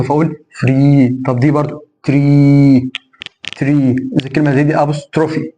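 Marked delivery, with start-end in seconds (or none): 0.6-4.0 فري and تري with a long held ee; long final ee. Shown as a demonstration. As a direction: stretch the endings of فري and تري. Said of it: "تنطق كالياء الممدودة". (فري وتري بياء ممدودة)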